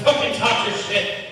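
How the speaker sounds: ordinary talk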